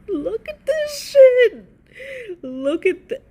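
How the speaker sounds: high, loud, slow shriek of disbelief; shocked disbelief. Real, not acted. Streamer gawking at the outfit, loud stretched shriek. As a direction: drag it out in outraged amazement.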